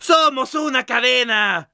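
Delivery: hammer character. Acted. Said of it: "dice el martillo".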